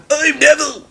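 high-pitched friendly greeting; high character voice; smiling engine. A narrator's reading. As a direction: greet the lady with a smile as a train. Neville introduces himself.

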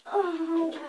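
pained groan from soreness; sore muscles. Real, not acted. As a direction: groan like it aches. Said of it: "I'm saying too much ow".

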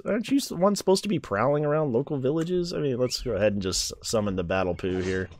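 usual speaking voice

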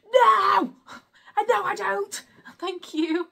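loud, high, sharp no; refusing a manicure offer with a shout; mock blunt refusal. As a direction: bark a flat refusal, half-laughing. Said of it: "not like that".

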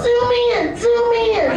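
put-on high, small child's plea; mock the child in the ad; imitating a starving child. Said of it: "two-year-old going".

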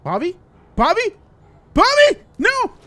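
questioning call building to a high scream; rising alarm. Acted. Bobby has not surfaced, friend calls in rising panic.

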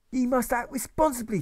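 high, loud furious schoolmaster; furious cry. Acted. Teacher furious with the children, high angry cry. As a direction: cry it out in a fury. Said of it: "he cries with furious eyes".

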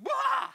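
sudden high loud yelp; shocked cry. Retold or imitated. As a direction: burst out of the water with a startled yell. Woman's shocked cry rising from water.

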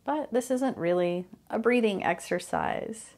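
calm, unhurried meditation guide with long pauses; guided meditation. Real, not acted. Host guiding listeners through mindfulness of breathing, calm guided meditation. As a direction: ease listeners into the breath, phrase by phrase.

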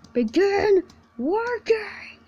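slow, high, drawn-out command. Acted. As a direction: order it slowly and firmly. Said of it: "I said".

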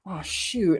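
ordinary talk

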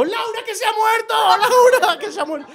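high, laughing mock wail; mocking mother calling. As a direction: cry out the name in a squeaky mum voice. Mother's call mocked. (imitando a la madre gritando agudo entre risas)

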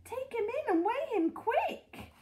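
high, drawn-out urgent command; insistent, high-pitched instruction; urgent order. A narrator's reading. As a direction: order her to hurry. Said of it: "Mister Hoppy ordered".

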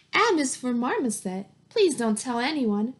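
ordinary delivery

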